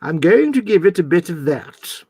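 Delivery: slow, put-on posh presenter; YouTube presenter voice. Shown as a demonstration. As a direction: announce it like a mock-posh tutorial host. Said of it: "it was your YouTube voice".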